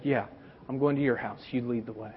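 low, quiet, tender answer; compassionate reply. Retold or imitated. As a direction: reassure the frightened father tenderly.

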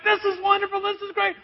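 high, loud, gleeful praise; delighted fish. Acted. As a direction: gush like a fish thrilled with its prize. Fish swimming off with bait.

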